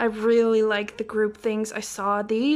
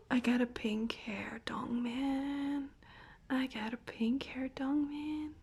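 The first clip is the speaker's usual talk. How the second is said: breathless whisper of disbelief; awed whisper; 11 dB quieter than usual. Real, not acted. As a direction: whisper it like a secret treasure. Whispers over the card in awe.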